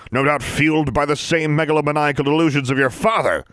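a pompous disdainful inspector; sneering official. Acted. Mister Brown sneering at Livia's plans.

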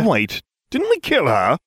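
high-pitched puzzled exclamation; exclaiming. A narrator's reading. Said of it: "I exclaimed".

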